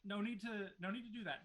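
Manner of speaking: high, hurried refusal; Moorcroft's voice. Acted. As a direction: wave them off in a high voice.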